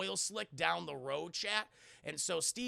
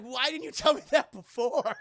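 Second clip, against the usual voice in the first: loud, high question through laughter; laughing outrage; 4 semitones higher, 9 dB louder than usual. Acted. Steve asks the doctor why he hid it, laughing. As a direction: crack up while protesting.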